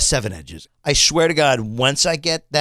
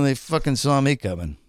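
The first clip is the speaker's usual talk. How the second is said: normal talking voice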